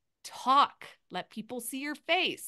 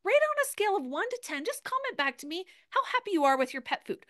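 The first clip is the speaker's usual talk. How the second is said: upbeat story host; 8 semitones higher than usual. Shown as a demonstration. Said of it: "being like".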